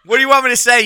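high, loud, laughing it out; laughing accusation. Real, not acted. Host laughs at friends for peeing on his car, high and loud. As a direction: accuse them through laughter.

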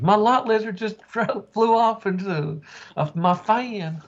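high, drawn-out comic lament; mock distress with a chuckle inside; panicking trucker bit. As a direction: wail it as a hammed-up trucker. Acting out trucker's panic, amused.

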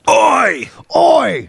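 high British "Oi!"; hammed-up English shout; mock British yell. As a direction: call out high in a cartoon British accent. Playing up the British voice.

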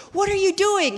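very high, loud disbelief; incredulous yell. Retold or imitated. Friend to the jouster who won't move, incredulous yell. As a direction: cry out in acted exasperation.

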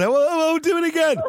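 put-on high whiny kid's retort; mock child's squeak; child answering back. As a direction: pipe up like a cheeky child. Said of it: "turn around and say".

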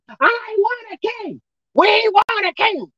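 high, drawn-out mock scream; screaming crowd. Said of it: "they were screaming on".

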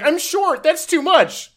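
very high-pitched mock complaint; put-on high voice, objecting; protesting short person. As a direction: squeak out a comic protest. Acted protest of a kilt wearer.

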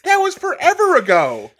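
high, loud squeal of disbelief on season; shocked disbelief voice. Shown as a demonstration. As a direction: squeak it out, as if you can't believe it. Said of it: "do 'em really quick".

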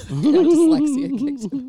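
high, loud laughing admission. Real, not acted. Owning up to swapping the numbers, laughing.

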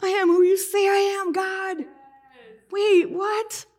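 high, loud tearful cry; breaking into tears. Retold or imitated. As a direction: reenact a sudden tearful realisation. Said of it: "I break into tears".

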